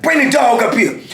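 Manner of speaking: high, calling command; commanding sergeant. Retold or imitated. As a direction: imitate an officer calling out an order. Sergeant calls for the dog.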